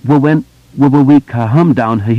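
heavy stammer, pushing through; stuttering boy. A narrator's reading. Bill stutters his answer.

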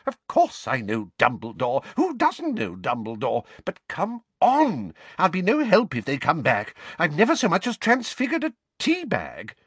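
put-on high, flustered old lady voice; a fretful, scolding old neighbour; fussy old woman. Mrs Figg voice again.

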